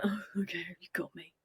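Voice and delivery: whispered, mock-sheepish surrender; caught-out dog. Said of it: "and she's like".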